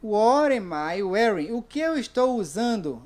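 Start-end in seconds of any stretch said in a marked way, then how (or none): 0.0-1.5 "What am I wearing?" said slowly, word by word; slow model question. Shown as a demonstration. Teacher to class, slowly modelling the first question. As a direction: spell out the question slowly for learners. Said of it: "Eu vou dizer assim".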